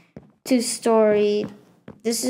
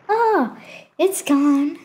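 lightly acted higher voice, pretend surprise; pretend visitor; 5 semitones higher, much slower than usual. Player imitates visitors losing items in the well, mock surprise. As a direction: play a passer-by noticing a loss. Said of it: "People are gonna be like".

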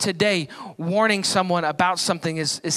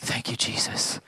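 low, whispered thanks; hushed, relieved little prayer; 10 semitones lower, 8 dB quieter than usual. Retold or imitated. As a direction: whisper it under your breath in relief. Relieved aside after getting warning.

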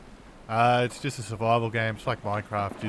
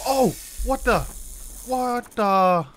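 gamer spotting a strange creature, gasps in shock; startled gasp; 9 semitones higher, slower than usual. Real, not acted. Sudden creature appears in game.

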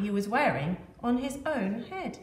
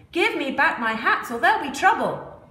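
loud, higher angry threat; 6 semitones higher, 6 dB louder than usual. Acted. Angry hat seller threatening the monkeys, loud and higher. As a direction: shake a stick and threaten. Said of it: "The man was angry".

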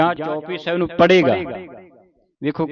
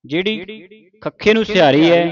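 ordinary talk

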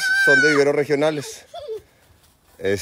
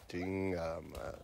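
ordinary talk

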